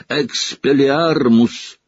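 high, slow, drawn out cry; boy casting a spell at his teacher; spell shout. Acted. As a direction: cast the spell with full force. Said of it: "крикнул он".

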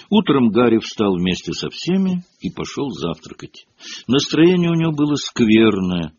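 ordinary delivery, nothing marked

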